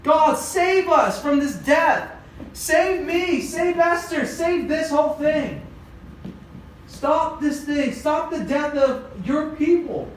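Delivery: high, urgent acted prayer; desperate pleading, performed without sobs; crying plea. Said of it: "And he's crying".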